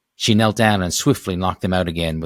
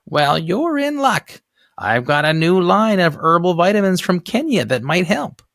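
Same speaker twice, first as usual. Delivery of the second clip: put-on chirpy old neighbor; 11 semitones higher, 4 dB louder than usual. Neighbor greeting Missus Constance, high old lady voice. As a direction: sound like a nosy elderly saleswoman. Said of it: "Sobalski selling vitamins".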